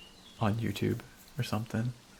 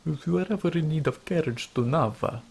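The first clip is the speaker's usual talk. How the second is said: imitating the driver's Russian accent, a bit louder; mock Russian accent; 5 semitones higher, 5 dB louder than usual. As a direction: parrot the NPC in a hammed-up Russian accent. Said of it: "Her accent's funny".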